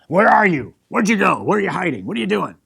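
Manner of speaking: loud, harsh shouted demand; harsh angry reading. Shown as a demonstration. Preacher mimicking an angry God hunting Adam, loud harsh shouting. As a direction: bark it like an angry accuser hunting someone. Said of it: "some of us might read this and go".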